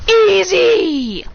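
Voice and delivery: high, loud victory shout; gloating shout. Acted. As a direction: crow it loudly, drawn out. Acted gloating after the win.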